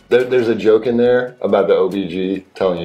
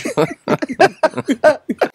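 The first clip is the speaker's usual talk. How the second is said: burst of laughter. Real, not acted. Laughing at a dad joke.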